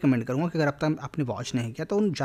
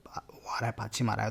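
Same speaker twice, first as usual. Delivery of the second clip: whisper; 4 semitones lower, 7 dB quieter than usual. Real, not acted. Teacher whispers a side remark.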